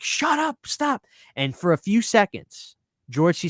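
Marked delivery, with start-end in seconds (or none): 0.0-1.0 high-pitched mock yell, no added volume; imitated yell. Film fan acts out the character yelling in the scene, high mock yell. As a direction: act out his yell, pitched way up. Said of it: "and he yells".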